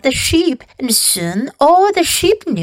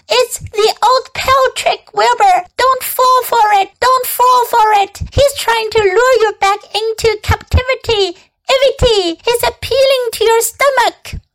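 high, insistent goose warning; alarmed goose; 11 semitones higher than usual. A narrator's reading. Goose warns the pig of a trap, alarmed. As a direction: plead urgently in a high bird voice. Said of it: "said the goose".

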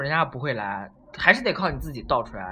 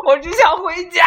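mock tearful whine; 13 semitones higher, 6 dB louder than usual. Retelling wanting to go home.

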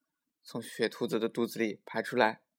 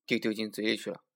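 usual speaking voice

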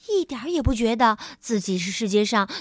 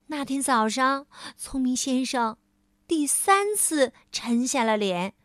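ordinary delivery

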